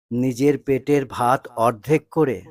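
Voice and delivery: ordinary delivery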